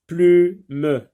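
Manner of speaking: "plume" broken into plu, then me; high, loud "plume" with a gap before the final sound; split into syllables. Shown as a demonstration. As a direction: loudly sound out "plume" in two parts. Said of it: "dans la première syllabe".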